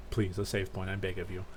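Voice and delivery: low, soft begging aside; quiet pleading. Real, not acted. Player begging the game for a save point, quiet low plea. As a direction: plead under your breath.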